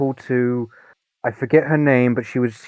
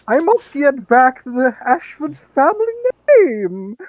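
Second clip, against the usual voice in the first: higher, self-mocking aristocrat voice; bad Alfred Ashford; 12 semitones higher, 3 dB louder than usual. Friend doing his own bad Alfred Ashford impression, higher mock noble voice. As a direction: show how badly you played him. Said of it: "my interpretation was bad when I cosplayed".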